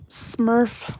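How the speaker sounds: "Smurf" said with a distinct opening S; careful pronunciation. Shown as a demonstration. Streamer retrying a slurred word, "Smurf" with a clear S. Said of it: "when I was trying to say it".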